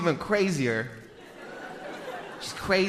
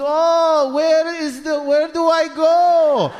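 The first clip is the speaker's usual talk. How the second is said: high, loud helpless wailing; feigned confusion; 9 semitones higher, 4 dB louder, much slower than usual. Acted. Dad playing lost in bank, exaggerated confusion. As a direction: act lost, stretching the words out. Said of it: "they always act confused".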